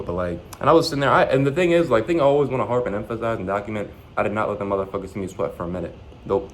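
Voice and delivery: usual speaking voice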